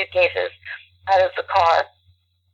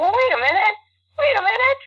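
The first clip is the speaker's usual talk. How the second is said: excited realization; 14 semitones higher than usual. Retold or imitated. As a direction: voice leaps high with excitement. Retold sudden idea, excited.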